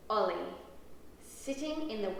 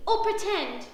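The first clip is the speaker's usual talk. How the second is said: loud, high question; amazed child; 8 semitones higher, 8 dB louder than usual. A narrator's reading. Ruby wonders about the puppy, amazed. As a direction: ask it pitched up, wide-eyed.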